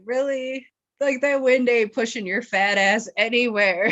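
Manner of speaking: incredulous retort. Retold or imitated. Friend mocking drunk girl's excuse, loud and incredulous. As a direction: scoff at her like she's crazy. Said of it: "looked at her like".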